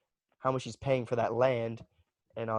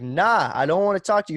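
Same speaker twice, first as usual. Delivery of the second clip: brushing off; 6 semitones higher, 9 dB louder than usual. Retold or imitated. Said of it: "I was like".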